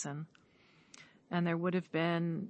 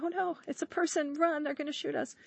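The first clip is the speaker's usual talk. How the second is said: panicked bear; 10 semitones higher than usual. Acted. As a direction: play a bear alarmed at spotting people. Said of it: "they'll hear us and they'll be like".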